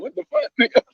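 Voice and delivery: a high, hammed-up what-the-fuck; mock outrage. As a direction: fire it back high in disbelief. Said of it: "I'm like".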